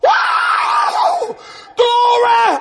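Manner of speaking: high-pitched "wow" whoop, then loud shout; ecstatic shout. Retold or imitated. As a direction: cry out in high-pitched praise. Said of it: "I was standing on my chair going".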